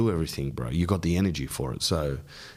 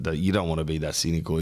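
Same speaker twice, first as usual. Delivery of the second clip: normal talking voice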